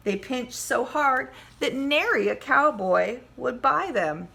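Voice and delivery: lively storybook read-aloud; story reading. Acted. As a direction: read it to the class with relish. Teacher reads picture book aloud.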